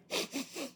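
soft sniffling cry demo; quiet sniffle. Shown as a demonstration. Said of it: "or do you, like".

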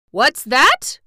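first wail, high and loud. Acted. Mister Cinnamon Bun cries out at the news, wailing. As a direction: start the alarm with a wail. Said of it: "wailed Mister Cinnamon Bun".

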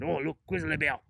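lightly put-on loud voice; hammed-up loud spotting call; mock tourist call. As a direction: joke imitation of the grizzly man. Running joke imitation.

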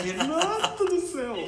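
high, slow laughing cry; laughing exclaim. Real, not acted. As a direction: laugh out in disbelief. Host laughing at revelation.

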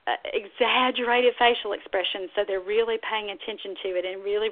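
usual speaking voice

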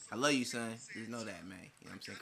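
low, hushed aside to a son; quiet, low reassurance; real fatherly tenderness. Real, not acted. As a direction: say it softly and sincerely. Tells his son he loves him.

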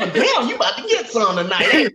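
teasing clerk. Retold or imitated. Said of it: "don't say".

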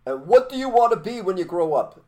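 teacher's call. Retold or imitated. Soviet teacher quizzing schoolkids, high and loud. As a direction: ring out the question to the class. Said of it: "they would ask you".

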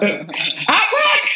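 high giggling laugh. Real, not acted. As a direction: burst out laughing at yourself. Laughing at own story.

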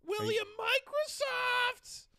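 very high, loud mom call; slow sing-song shout to the kid; calling kids to dinner. Acted.

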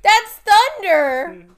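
loud, slow, high call out. Real, not acted. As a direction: call the cat's name across the room. Shouting at the cat.

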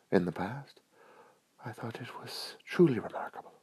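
mock-genteel admirer, very soft and high; imagined gushing admirer. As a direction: coo soft praise, nearly whispering in places. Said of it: "The first reaction could be".

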